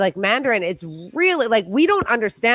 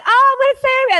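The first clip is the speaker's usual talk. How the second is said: high mock line; 6 dB louder, faster than usual. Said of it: "we're like".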